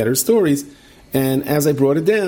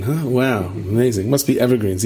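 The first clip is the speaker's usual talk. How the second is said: ordinary talk, nothing marked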